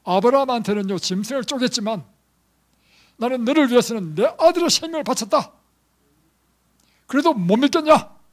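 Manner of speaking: God challenging the doubter, shouting; thundering God voice. Acted. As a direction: proclaim it like God rebuking doubt. God's voice preached at full force.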